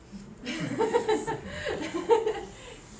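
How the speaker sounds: high burst of real laughter; laughing. Real, not acted. Teacher amused after sounding out letters, laughing. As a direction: break into a giggling fit.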